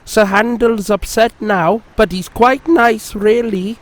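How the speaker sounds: a sweet little engine being kind; friendly Peter Sam cheering up his grumpy friend. A narrator's reading.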